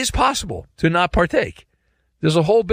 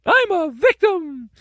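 very high, whiny put-on victim; mock the self-pitying in a high cartoon voice; cartoonish victim voice; 12 semitones higher than usual. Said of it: "That's how they sound, too. They have this very weird voice. It's a cartoonish voice".

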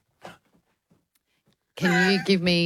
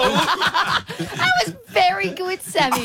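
laughing; 13 semitones higher, much slower than usual. Real, not acted. Laughing after the impression.

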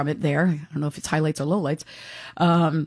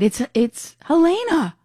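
higher, slow mock announcement; imitating Eva Mancuso; 8 semitones higher, slower than usual. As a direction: mimic her saying the name slowly. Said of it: "she said".

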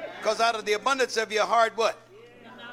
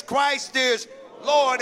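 "Lord" drawn out slowly and weighted; slow emphatic stress; much slower than usual. Acted. Pastor telling the church how to be saved, slow weighted stress. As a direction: land "Christ is Lord" very slowly. Preacher driving home confession.

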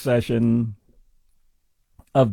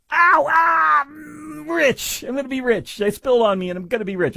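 high cry of pain turning gleeful; mocking greedy victim; 11 semitones higher than usual. Parody of the coffee victim, high mock yelp then gloating. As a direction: fake a burn, then gloat about money. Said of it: "everybody goes".

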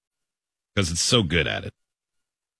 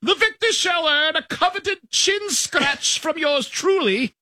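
lofty, high, slow royal cat; high-pitched regal voice; slower than usual. Acted. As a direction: proclaim like a pampered queen. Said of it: "Played with a high-pitched regal voice".

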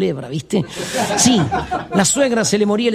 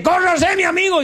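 pushy gaucho; 10 semitones higher than usual. Acted. Gaucho pushing in.

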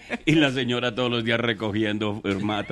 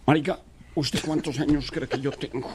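1 character voice; 7 semitones higher than usual. Retold or imitated. Joke character asks his age.

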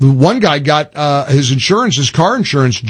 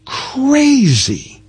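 high, drawn-out "crazy" that falls away; long, incredulous swoop on "crazy"; exasperated emphasis; 10 semitones higher than usual. Real, not acted. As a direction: stretch "crazy" from high pitch downward. Host exclaims over political opponents.

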